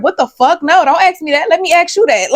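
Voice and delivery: high, loud mock protest; flustered inner outburst. Thrown by a child's grown-up question, mock-flustered protest. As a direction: protest in astonishment, pitched right up.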